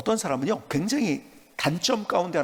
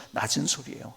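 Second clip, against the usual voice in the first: ordinary delivery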